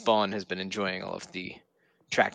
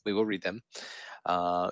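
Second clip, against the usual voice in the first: ordinary talk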